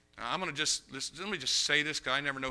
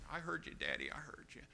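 frightened hush; 2 semitones higher, 10 dB quieter than usual. Retold or imitated. Frightened girl answering.

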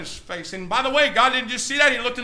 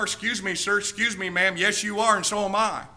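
ordinary talk

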